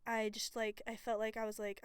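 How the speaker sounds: normal talking voice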